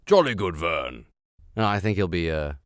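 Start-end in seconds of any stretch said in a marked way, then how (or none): 0.0-1.1 a lightly voiced portly older British gentleman; jolly old English chap, a touch lower; posh British voice. Reader trying out the narrator voice, mock British accent. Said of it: "portly older British man that reads".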